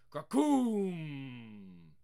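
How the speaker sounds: booming thunder sound effect; thunder crack. Acted. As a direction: roll it out like thunder overhead.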